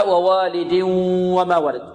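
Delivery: slow recitation, nasal hum held from ووالد into وما; إدغام بغنة. Shown as a demonstration. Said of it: "إذن هنا". (تلاوة ووالد وما ولد بغنة ممدودة)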